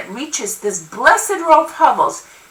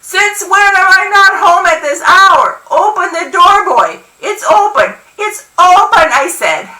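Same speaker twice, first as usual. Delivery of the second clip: high, loud exasperated holler; ear-splitting scolding shout; 7 semitones higher, 5 dB louder than usual. A narrator's reading. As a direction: holler it at a boy outside the door. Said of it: "She then hollers past me, loud enough to blow my ears off".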